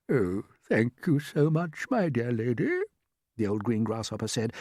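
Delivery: a hint of a contented old gent, slow; lightly voiced old grasshopper. Grasshopper climbing into the hammock, lightly voiced contented old gent. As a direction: settle in with a satisfied chuckle.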